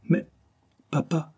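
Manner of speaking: small, high, hesitant voice; stammering with emotion. A narrator's reading. Marie answers William's declaration, stammering with emotion. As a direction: falter between the words, overcome. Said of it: "balbutia-t-elle, incapable de réprimer son émotion".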